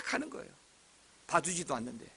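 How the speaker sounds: ordinary talk, nothing marked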